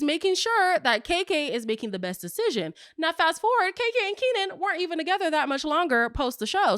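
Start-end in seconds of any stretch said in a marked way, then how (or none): none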